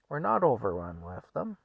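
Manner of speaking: offhand, level-voiced denial; unbothered, at an easy volume; nonchalant husband. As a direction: brush off her complaint calmly. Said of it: "just very nonchalantly".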